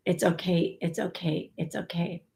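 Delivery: soft, fading, comforting repetition; reassuring. Real, not acted. Medium passing on comfort to the grieving sitter, softly reassuring.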